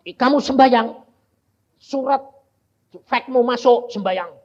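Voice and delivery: preacher booming the command at the church; forceful call. Real, not acted.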